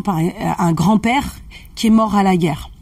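usual speaking voice

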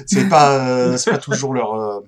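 talking just after a sip. Real, not acted. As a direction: voice wet from drinking. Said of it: "excusez-moi, je bois".

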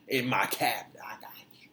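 whispered secret promise; mock whisper. Host imitating Trump whispering a promise to supporters. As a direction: lean in and whisper the deal. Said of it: "he was really just like".

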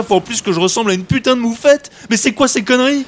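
high, loud indignant outburst; outraged rant. Acted. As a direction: rant in disbelief. Outraged at being a skunk.

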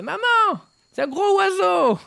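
petite voix de fillette, très aiguë; voix de Bourville. Acted. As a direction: imiter une fillette niaise, très haut. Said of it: "la voix de Bourville". (voix de Bourville en fillette)

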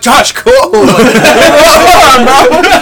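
laughing high mock plea; laughing while calling to a friend. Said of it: "I'm like".

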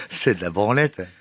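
said out loud and firmly. Real, not acted. Repeats dismissal aloud.

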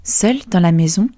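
ordinary delivery

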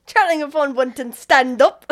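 loud, hammed-up British accent; mock British, belted out. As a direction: put on a rowdy British street voice. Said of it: "ask somebody to do a British accent".

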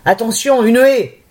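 sudden high warning call; teacher calling out. Acted. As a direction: call it out like a coach. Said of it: "puis le prof crie".

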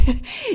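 a quick high real laugh; short laugh. Real, not acted. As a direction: laugh it off, embarrassed. Laughs after confession. (petit rire gêné et aigu)

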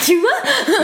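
aigu, porté par un rire; lâche la question en riant. Real, not acted. Rit en taquinant son collègue. (pour taquiner son collègue, en riant)

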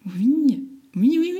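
high, loud, overwhelmed yeses; excited. Retold or imitated. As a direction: blurt out yes again and again. Emotional yes to mother. (oui répétés aigus et émus)

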